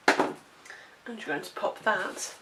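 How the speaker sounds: ordinary talk, nothing marked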